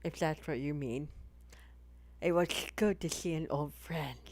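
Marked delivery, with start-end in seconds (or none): 2.2-4.2 menacing horror reading voice; murder face voice. Shown as a demonstration. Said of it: "Read like murder face from Deathlock".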